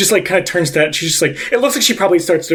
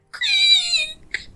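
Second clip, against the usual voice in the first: voiced door creak; 5 dB quieter than usual.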